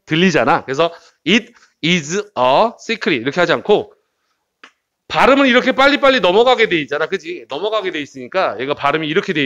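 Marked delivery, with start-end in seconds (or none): 1.3-3.2 "it is a secret" slowly, every word apart; over-careful word-by-word. Shown as a demonstration. Teacher showing how not to say it, slow separate words. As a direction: spell out "it is a secret" word by word like an announcer. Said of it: "하나하나 발음하면 마치 아나운서".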